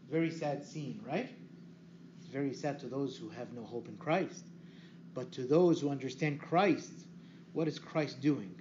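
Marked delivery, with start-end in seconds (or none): none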